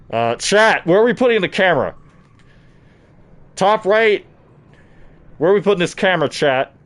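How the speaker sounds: high, loud call to chat. Real, not acted. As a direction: shout the question to chat. Loudly asking chat through mask.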